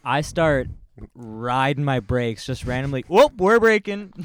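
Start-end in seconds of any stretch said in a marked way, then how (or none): 3.1-4.1 high, loud, gleeful whoop; mock cheerful shout. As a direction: sing it out like taunting the driver behind. Retelling brake-checking a tailgater.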